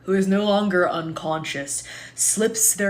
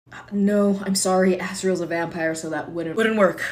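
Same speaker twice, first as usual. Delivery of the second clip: ordinary talk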